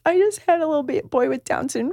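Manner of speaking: high, mildly tearful retelling; imitated tearful mother. New mother approaches a parent at school night, imitated tearful confession. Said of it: "she starts crying".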